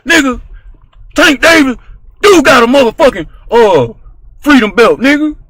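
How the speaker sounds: loud yelled version. Shown as a demonstration. Yelling it at the debate show. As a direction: yell it like a heated YouTuber. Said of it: "Or I can say".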